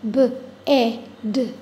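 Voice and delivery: B-E-D as separate sounds, buh, eh, duh; phonics-style B-E-D, sound by sound; slow, sounded out. Shown as a demonstration. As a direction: sound out B-E-D slowly with a pause after each sound. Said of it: "Let me say it slowly".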